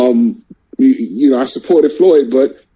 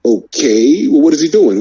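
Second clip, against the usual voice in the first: ordinary talk, nothing marked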